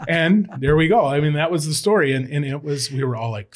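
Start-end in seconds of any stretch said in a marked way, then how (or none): none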